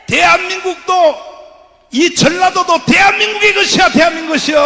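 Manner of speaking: preacher calling the crowd to rise up; fiery rally preaching. Acted. Rally sermon, full force.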